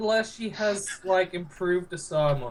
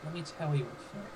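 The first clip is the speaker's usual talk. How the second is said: much softer, quick confidential aside; whisper; 3 semitones lower, 10 dB quieter, faster than usual. Acted. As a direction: lean in and whisper it low. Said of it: "lean over to the mayor and whisper".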